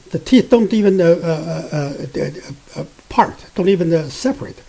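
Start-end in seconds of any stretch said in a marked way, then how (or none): none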